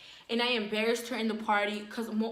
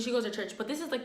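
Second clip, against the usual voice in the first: ordinary delivery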